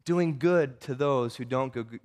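usual speaking voice

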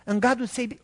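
ordinary talk

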